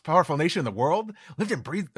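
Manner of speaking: put-on excited, childlike Luffy. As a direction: prattle on like an excited kid. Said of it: "Luffy's got this kind of excited, childlike".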